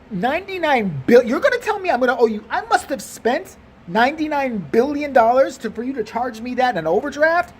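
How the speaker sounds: high, incredulous protest; outraged disbelief. Acted. Customer challenging the teller over the debt, incredulous.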